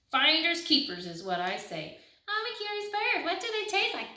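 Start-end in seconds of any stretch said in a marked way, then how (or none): 2.3-4.1 mocking the duckling.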